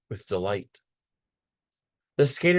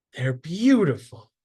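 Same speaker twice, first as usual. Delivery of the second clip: higher and louder, delighted; beaming; 6 semitones higher, 4 dB louder than usual. A narrator's reading. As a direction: gush with delight over new boots. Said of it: "she beams".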